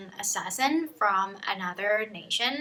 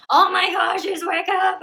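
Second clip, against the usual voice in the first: high, loud panicked gasp; mock panic at her waking; scared soldiers; 9 semitones higher, 7 dB louder than usual. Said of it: "They're like".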